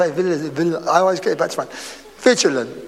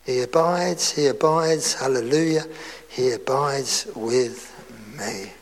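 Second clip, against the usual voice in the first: soft hymn reprise; 5 semitones lower, 6 dB quieter than usual. Acted. Preacher recalling the hymn after saying He lives within, softly. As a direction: ease gently down to a hush.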